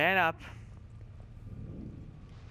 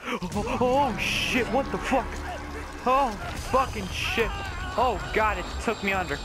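high, loud frightened cries; panicked; 5 semitones higher, 3 dB louder than usual. Real, not acted. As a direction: yelp in real panic. Jump scare in horror game.

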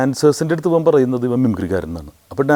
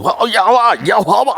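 much higher, louder eager calling; 9 semitones higher, 4 dB louder than usual. Retold or imitated. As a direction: beckon him over like a marvel. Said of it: "എന്നൊക്കെ പറഞ്ഞിട്ട്".

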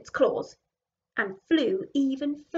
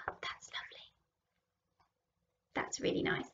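whispered, hushed and admiring; soft whisper; 12 dB quieter than usual. Real, not acted. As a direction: breathe it in quiet awe.

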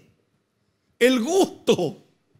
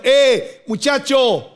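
loud, higher call across the water; shouting from the shore; 6 semitones higher, 4 dB louder, slower than usual. Retold or imitated. Jesus calling out loudly to the fishermen from shore. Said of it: "le está gritando".

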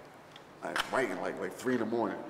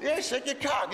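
hyped-up cheering. Retold or imitated. Abel hyping the rapper on set, high laughing cheer. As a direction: egg him on with laughing excitement.

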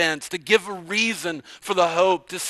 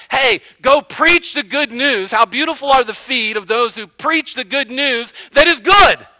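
voicing the apostle; 5 semitones higher, 6 dB louder than usual. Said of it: "So he really is saying".

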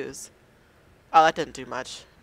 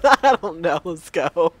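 laughing; 7 dB louder, slower than usual. Real, not acted. Laughing at himself.

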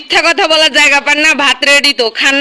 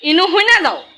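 Mymensingh dialect; 4 semitones higher than usual. Shown as a demonstration. Calling someone over in Mymensingh dialect. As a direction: come here and listen, in Mymensingh dialect. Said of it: "আঞ্চলিক ভাষায় কি হবে".

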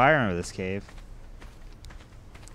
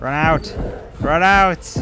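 loud rising yell to flee; urgent shout; 6 semitones higher, 7 dB louder, much slower than usual. Real, not acted. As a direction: shout the warning, louder each time. Urging friend to flee.

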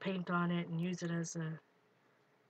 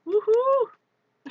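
high, loud "woohoo" cheer; excited whoop; 11 dB louder than usual. Real, not acted. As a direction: whoop with delight at speeding along. Cheering at faster stenciling.

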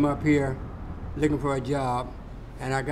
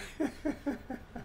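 soft breathy chuckle; 10 semitones higher, 11 dB quieter than usual. Real, not acted. Amused at worn-out boots.

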